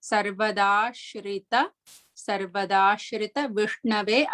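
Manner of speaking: sarvadashrita vishnave slowly, with a flat-tongue sha; careful pronunciation. Shown as a demonstration. Teacher models the sha sounds for students, slow and careful. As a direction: draw out sarvadashrita vishnave syllable by syllable. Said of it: "tip of the tongue should be straight".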